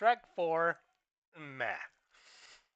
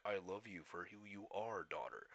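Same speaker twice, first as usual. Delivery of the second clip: deep dad voice; 7 semitones lower, 12 dB quieter than usual. Retold or imitated. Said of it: "and he's like".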